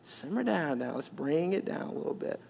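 soft, low reassurance; calming Jesus. Acted. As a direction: settle her down gently.

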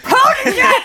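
high, loud call from another room; distant yell. Acted. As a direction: shout it from down the hall. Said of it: "Madame L yells from the other room".

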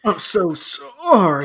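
slow, high, loud mocking whimper of an apology; whiny mock apology. Friend mocking the over-apologetic customer, slow high whine. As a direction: drag out the sorry like a pathetic sad sack.

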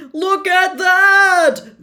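high, loud drawn-out shout; amazed, full-voiced announcer's yell. A narrator's reading. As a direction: yell it out like an excited commentator. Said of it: "Bagman was yelling".

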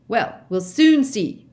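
slow, loud menacing growl. A narrator's reading. Mister Diggory brushing off the children, slow loud growl. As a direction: let the threat rumble out. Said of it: "growled Mister Diggory".

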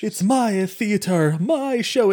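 high, slow, pretentious thespian voice; actor speak. Retold or imitated. Co-host mocking a pretentious theater director, hammed-up actor speak. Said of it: "it's actor speak".

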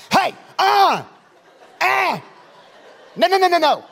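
high, loud mock scolding calls; calling out. Preacher catching people leaving early, playful shouted warning. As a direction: yelp at someone to stop right there.

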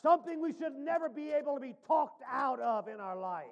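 ordinary delivery

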